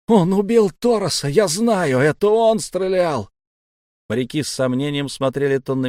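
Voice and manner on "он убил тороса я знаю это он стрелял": loud, high, desperate shouting; frantic accusation. A narrator's reading. Boy denouncing the shooter to the crew, frantic. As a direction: shout it so every man hears.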